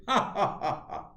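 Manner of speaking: high, staccato fake "ha ha ha"; mocking bully laugh. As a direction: put on a bully's jeering laugh.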